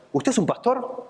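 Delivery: high, astonished question; can't believe a pastor is at his door; amazed old man. Retold or imitated.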